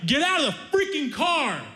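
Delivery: high-pitched angry mock yell; pissed-off yell. Angry stranger ordering them out of the car, mock yelling. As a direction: imitate an enraged driver, pitch way up. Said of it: "and he's pissed".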